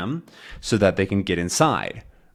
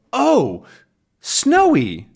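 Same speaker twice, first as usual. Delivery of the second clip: high, louder voiced surprise; surprised exclamation; 14 semitones higher, 5 dB louder, much slower than usual. Tintin finding Snowy on deck, surprised. Tintin spots his dog.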